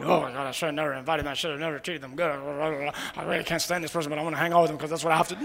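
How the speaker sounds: low muttered grumble, rushed; grumbling. Acted. Preacher mimicking a reluctant host grumbling about guests, grumbling. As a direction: grumble under your breath, quickly and low.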